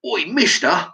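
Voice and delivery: put-on high, cheeky street-kid call; rude kid calling out. A kid rudely hailing an adult: high cheeky call. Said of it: "he referred to me as".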